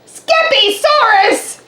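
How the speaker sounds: high, slow, loud astonished cry; declared. A narrator's reading. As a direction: exclaim it like a startled mother. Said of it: "declared Mama Junebug Jones".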